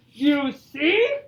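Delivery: loud, lightly voiced bear; a hint of a triumphant storybook bear; gloating Bear. Reads Bear's gloating line.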